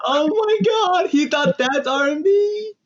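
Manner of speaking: high, drawn-out incredulous cry; slow, high-pitched astonishment; amused disbelief. Real, not acted. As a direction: squeal it slowly in disbelief. Laughing disbelief at [name]'s claim.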